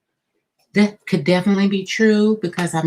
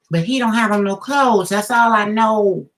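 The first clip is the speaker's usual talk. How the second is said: louder, higher teasing delivery; amused; 5 semitones higher, 6 dB louder than usual. Real, not acted. Reader teasing the viewer about the lover, amused.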